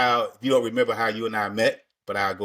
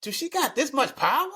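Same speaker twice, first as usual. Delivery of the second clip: mock alarm; 14 semitones higher than usual. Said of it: "I'm like".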